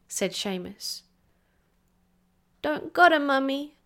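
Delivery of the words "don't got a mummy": put-on higher, louder plain child talk; a small girl speaking simply; little girl voice. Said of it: "little girl sentences".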